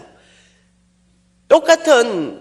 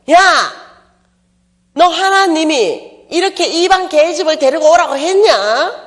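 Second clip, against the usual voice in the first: sharp, higher, louder scolding of a younger brother; scolding older sister; 6 semitones higher, 4 dB louder than usual. Pretend. Preacher voicing Miriam scolding Moses at home. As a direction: bark it like a big sister telling off her brother. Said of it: "이렇게 해야 돼요".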